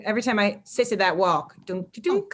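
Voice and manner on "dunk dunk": high, soft "dunk" effect; imitated weird noise. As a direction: mimic a fork dropped in a tin drum. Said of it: "like the weirdest noise".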